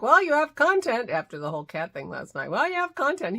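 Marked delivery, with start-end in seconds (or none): 0.0-1.1 high, louder mock quote of her husband; lightly voiced Dennis, teasing; quoting husband. Wife imitating Dennis about content, higher and louder. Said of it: "he's like". 2.5-3.4 high, louder mock quote of her husband; lightly voiced Dennis, teasing; quoting husband. Wife imitating Dennis about content, higher and louder. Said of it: "he's like".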